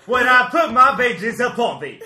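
loud, high, laughing proclamation; laughing mock decree. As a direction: thunder it while cracking up. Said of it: "I'm waiting for you to be like".

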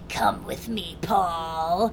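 put-on eerie monster voice, drawn out; spooky, unnatural mock-villain voice; weird creepy voice. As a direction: imitate a possessed robot beckoning someone. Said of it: "it's just, like, a weird voice".

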